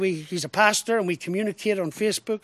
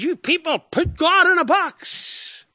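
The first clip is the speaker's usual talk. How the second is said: mocking the critic; 9 semitones higher, slower than usual. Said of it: "He said".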